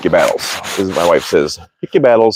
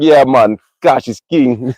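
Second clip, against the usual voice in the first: put-on Jamaican accent, pitched up; 4 semitones higher, slower than usual. Shown as a demonstration. Showing viewers how to say it, in a Jamaican accent. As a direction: play up an imitated Jamaican accent. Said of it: "It's with an accent".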